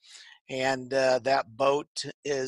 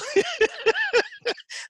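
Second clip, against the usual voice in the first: high laugh; 3 dB louder than usual. Real, not acted. Joking about the accent.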